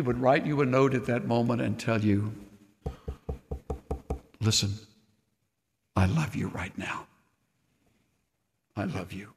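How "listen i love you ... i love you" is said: soft loving reassurance; tender. Retold or imitated. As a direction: speak it gently as God to a hurting soul. Voicing God's tender note.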